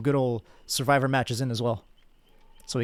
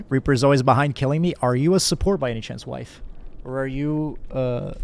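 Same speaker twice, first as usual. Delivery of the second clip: normal talking voice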